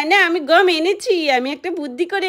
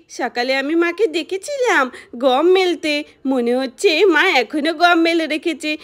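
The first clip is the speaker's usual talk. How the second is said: ordinary delivery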